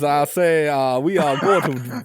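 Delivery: a hammy Southern gentleman rallying troops; Southern accent. Retold or imitated. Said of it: "delightful Southern accents".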